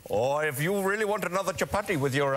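put-on Indian accent. Shown as a demonstration.

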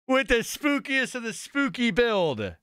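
high, slow, savoured boast; drawn-out, high-pitched victory call; triumphant proclamation. Real, not acted. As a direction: announce it grandly, stretching the words. Celebrates the win.

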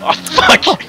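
high shouted curse; startled shout. Real, not acted. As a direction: yell it in shock. Crash, shouted.

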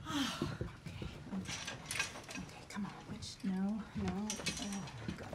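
hushed self-pep talk, sigh and okays barely voiced; muttering to herself. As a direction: psych yourself up in a hushed voice.

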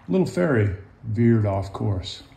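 ordinary delivery

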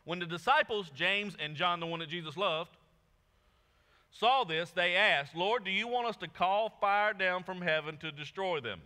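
proclaiming the verse; scripture reading. Acted. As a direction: read it out to the congregation. Reads scripture aloud.

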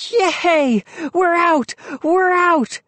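high, excited cheering; joyful cry. A narrator's reading. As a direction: shout for joy at being free. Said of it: "Moose cried".

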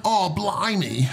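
high, drawn-out caricature British exclamation; put-on British accent. Shown as a demonstration. Guest asked to give a British accent, hammed-up British. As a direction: ham up a slow, high-pitched British accent. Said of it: "can you give us a British".

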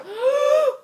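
loud, high gasp; sharp gasp of sudden fright; huge shocked intake; startled gasp. Real, not acted.

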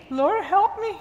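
quick high-pitched cry for help; urgent plea. Retold or imitated. Bible teacher put on the spot praying for help, pleading. Retold urgent prayer when challenged.

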